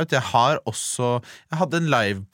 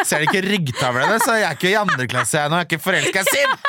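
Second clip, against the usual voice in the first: mock indignant hero; 7 semitones higher than usual. Mocking her as the hero.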